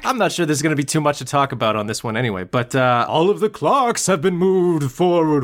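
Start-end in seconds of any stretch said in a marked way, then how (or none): 3.1-5.4 slow, drawn-out campy spookiness; playfully ominous, dragged out; mock-spooky. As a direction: stretch it out like a campfire ghost tale. Mock-spooky recap of movie pranks.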